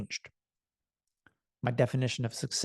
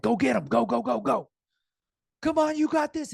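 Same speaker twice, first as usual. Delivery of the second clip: much higher, louder cheering; cheering hype man; 10 semitones higher, 3 dB louder than usual. Retold or imitated. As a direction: pump your fighter up from the corner. Corner man cheering fighter on.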